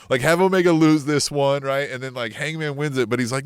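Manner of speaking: ordinary talk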